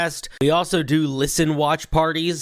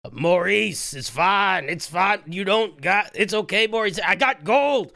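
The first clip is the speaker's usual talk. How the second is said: pleading, higher-pitched reassurance; mild mock yell; 5 semitones higher than usual. Game master as Aza calming Maurice while attacked, mild pleading yell. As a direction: call out pleadingly, climbing in pitch. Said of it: "And he is yelling".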